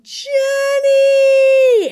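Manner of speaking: long, high, loud scream of the name. Retold or imitated. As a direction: call out in full panic from the stairs. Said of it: "she's screaming my sister's name".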